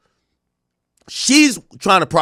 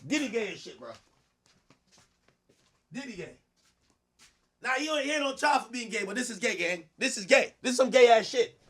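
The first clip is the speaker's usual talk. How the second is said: high soft laughing aside; 7 semitones higher, 8 dB quieter than usual. Real, not acted.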